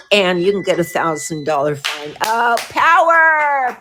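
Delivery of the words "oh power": excited. Real, not acted. Power back on after outage.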